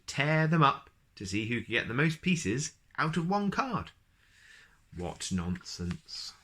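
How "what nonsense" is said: slow amused aside. A narrator's reading. Narrator's slow tag line.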